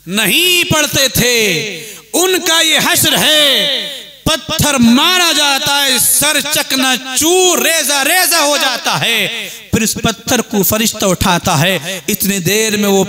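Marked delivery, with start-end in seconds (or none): 0.1-9.5 high, drawn-out sermon cry; forceful preaching. Acted. Preacher warning of punishment for neglected prayers, forceful preaching. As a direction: stretch each phrase as a solemn warning.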